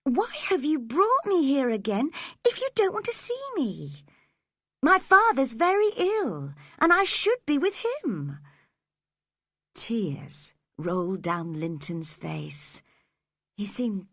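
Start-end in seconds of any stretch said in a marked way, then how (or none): 0.0-8.4 put-on upset girl, voice climbing; crying out. A narrator's reading. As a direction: plead and protest at once. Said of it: "cried Cathy at once".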